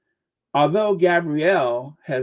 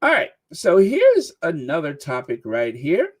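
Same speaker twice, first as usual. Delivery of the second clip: ordinary talk, nothing marked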